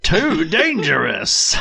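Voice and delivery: slow, drawn-out game voice; imitating a cheesy announcer. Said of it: "some voice is like".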